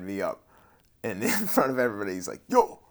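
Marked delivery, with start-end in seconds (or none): 2.5-2.8 a loud, high Jamaican "Yo!" called out across the cell; imitating a Jamaican Rasta hailing a newcomer, big and high; thick Jamaican accent. Rasta in the cell calling out to the new guy, loud high Jamaican accent. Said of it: "in a thick Jamaican accent".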